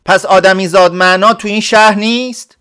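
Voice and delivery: high, loud indignant cry; shouted protest. Acted. Villager shouts back at the scolding clerk, high and loud. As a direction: shout it in disbelief. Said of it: "فریاد کشید".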